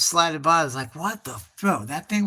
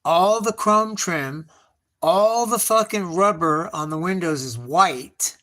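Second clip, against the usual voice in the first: ordinary talk, nothing marked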